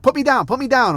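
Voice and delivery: mock toddler demand; imitating a little kid. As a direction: play the kid demanding to be set down. Toddler asking to be let down.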